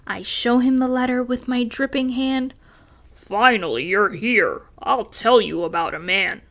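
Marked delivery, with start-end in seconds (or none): 3.1-6.4 low storybook hippo voice; hippo character. A narrator's reading. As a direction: drop the pitch for a big hippo. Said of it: "He glances my way".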